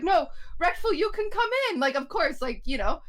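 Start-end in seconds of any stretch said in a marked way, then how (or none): 0.0-1.8 high, bright call to come in; mock eager reassurance; raised pitch welcoming a friend back; eager welcome. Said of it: "we were like".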